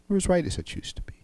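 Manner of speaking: breathy whisper, quick and hushed. Shown as a demonstration. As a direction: drop to a quick confiding whisper on as right. Plain wrong versus whispered right.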